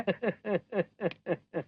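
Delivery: soft, smug villain chuckle; smug chuckle at the policeman; smug low chuckle. Acted. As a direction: chuckle low and pleased with yourself. Villain boasts.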